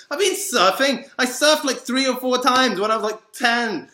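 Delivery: very high, loud protest; indignant shouting. Real, not acted. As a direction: yell your rebuttal in exasperation. Indignantly rebuts assumption about surfing.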